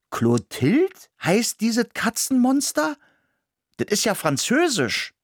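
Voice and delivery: high, amazed exclamation; astonished. A narrator's reading. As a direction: react with disbelief at the name. Mouse astonished at the cat's name.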